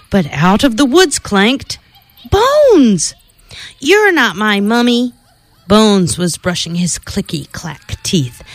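playful storybook narration with spooky sound words; reading to children. Acted. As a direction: read it like a bedtime story for kids. Reads Halloween picture book aloud.

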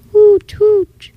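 high hooting call; owl hoot. A narrator's reading. The owl joining the night song, high hoots. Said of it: "Then the owl".

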